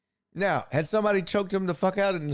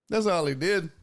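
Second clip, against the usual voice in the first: ordinary delivery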